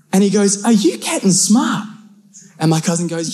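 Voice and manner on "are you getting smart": high, slow confrontational question; mock challenge. Stranger confronts a mouthy cousin, high mock challenge. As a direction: draw out a high-pitched challenge.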